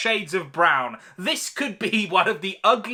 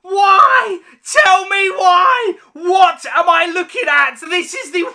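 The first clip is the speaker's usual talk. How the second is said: anguished shout; 8 semitones higher, 6 dB louder than usual. Real, not acted. Reviewer begging the film to explain its CGI, anguished yell. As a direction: scream it at the screen in despair.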